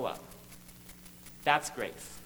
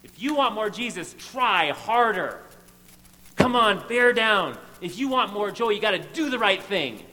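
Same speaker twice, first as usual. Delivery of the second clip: pushy coach voice; 4 semitones higher, 6 dB louder than usual. Retold or imitated. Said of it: "You might be hearing this as".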